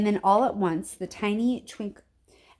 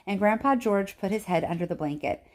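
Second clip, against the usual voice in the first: ordinary delivery, nothing marked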